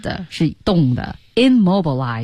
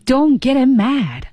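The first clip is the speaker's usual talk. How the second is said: slow, higher-pitched careful delivery; slow model reading; 7 semitones higher, slower than usual. Shown as a demonstration. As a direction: draw out each word for learners.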